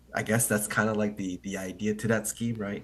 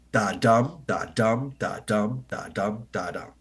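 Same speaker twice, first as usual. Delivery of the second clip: da dum beat that fades out; da dum rhythm. Shown as a demonstration. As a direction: tap out the iambic beat, weak then strong. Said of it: "unstressed followed by a stressed".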